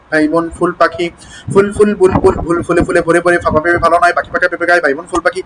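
tongue-twister gathering pace to a rapid rattle; accelerating low-pitched recitation; building speed. Shown as a demonstration. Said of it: "গতি বাড়াতে হবে".

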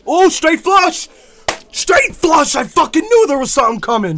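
ordinary talk

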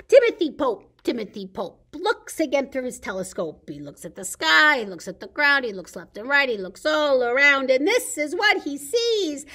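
loud, lively picture-book reading; storytime reading. Acted. Librarian reading Shark in the Park to kids, lively storytime voice. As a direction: read it big for the kids at storytime.

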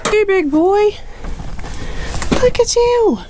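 high sing-song to a cow. Real, not acted. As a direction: coo at the big steer. Talking to the steer.

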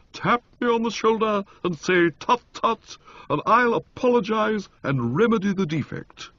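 high, ringing and rapturous; holy exaltation. A narrator's reading. Motty to Bertie vowing to drink, exalted and high. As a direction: proclaim it like a sacred vow. Motty continues his vow.

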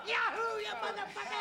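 high whooping cowboy yahoo; cowboy yell. Acted. Gangster plays Oklahoma Kid while making the waiter dance, whooping. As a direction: whoop it like a rodeo rider.

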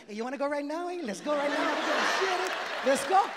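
hammed-up tiny brawler itching to fight; little Mexican tough guy. Tiny Mexican guy taunting someone to fight. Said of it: "You ever see them like".

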